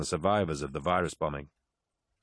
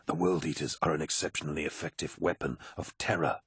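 lower, softer officer's voice; retorting Aximand; 3 semitones lower, 5 dB quieter than usual. A narrator's reading. Aximand disputes Abaddon's verdict, retorting quietly. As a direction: counter him coolly and quietly. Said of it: "retorted Aximand".

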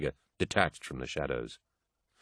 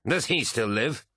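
higher, indignant question; spat question; 6 semitones higher than usual. A narrator's reading. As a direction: ask it with angry disbelief. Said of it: "spat Eidolon".

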